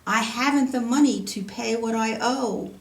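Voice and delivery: ordinary delivery, nothing marked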